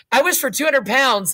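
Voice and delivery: distinct, lifted announcement; clear declaration. A narrator's reading. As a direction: proclaim the wish clearly. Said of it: "said the old man clearly".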